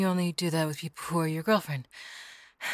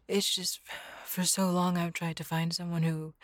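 ordinary talk

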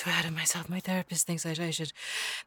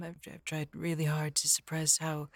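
whispering; 3 semitones lower, 4 dB quieter than usual. Acted. Whispered admission of clinginess.

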